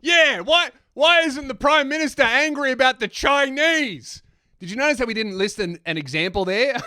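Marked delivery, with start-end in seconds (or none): 0.0-4.2 high, louder indignant rant; hammed-up angry tirade; mock outrage. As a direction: imitate a fired-up commentator demanding answers. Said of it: "It was just like".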